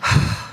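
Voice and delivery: a heavy, fed-up sigh before speaking; a real sigh of frustration; exasperated sigh. Real, not acted. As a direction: let out all the patience you have left. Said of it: "so hard to be patient here".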